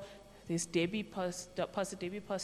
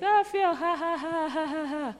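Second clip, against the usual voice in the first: forced laugh; 8 semitones higher than usual. Shown as a demonstration. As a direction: show how to laugh it off, faking it. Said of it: "try find the humor in it".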